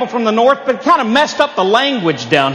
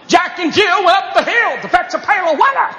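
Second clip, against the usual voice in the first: high preacher cadence on a nursery rhyme; preaching kid; 7 semitones higher than usual. Retold or imitated. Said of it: "he preached nursery rhymes".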